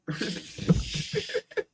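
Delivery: breathless high-pitched cackle; high squealing laugh. Real, not acted. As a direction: break into helpless laughter. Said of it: "I'm uncontrollably laughing".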